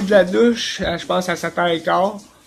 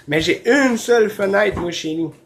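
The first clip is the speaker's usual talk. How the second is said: ordinary talk, nothing marked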